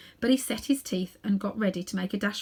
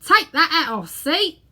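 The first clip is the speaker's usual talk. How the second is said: loud, high, stern warning; 8 semitones higher, 8 dB louder than usual. Acted. Policeman ordering Uncle Andrew to remove his hat, stern and loud. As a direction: lay down the law. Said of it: "said the policeman sternly".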